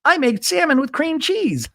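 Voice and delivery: very high, squealed mock voice; exaggerated high-pitched parody of a reality star; Shannon impression. Host imagines housewife popping up on an album, squealed parody. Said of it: "where she'd be like".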